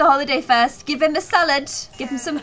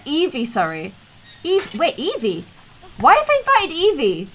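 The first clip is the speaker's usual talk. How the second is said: normal talking voice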